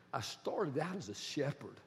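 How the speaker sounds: hushed, soft, amazed at his own life; awed David. Acted. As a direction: breathe it out in quiet wonder, half laughing. David awed, looking back.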